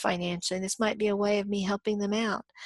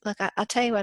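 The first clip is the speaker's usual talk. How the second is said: ordinary talk, nothing marked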